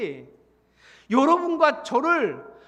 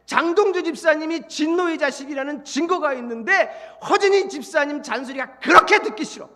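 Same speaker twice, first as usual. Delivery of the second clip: ordinary talk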